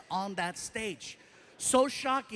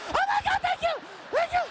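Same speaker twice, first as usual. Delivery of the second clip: very high, louder gushing thanks; squealing relief; 3 dB louder than usual. Retold or imitated.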